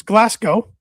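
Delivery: Glasgow said as GLASS-go; pronounced "-go". Shown as a demonstration. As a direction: end Glasgow on a long "oh". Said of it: "That's how it's pronounced".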